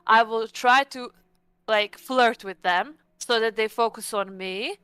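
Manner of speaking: usual speaking voice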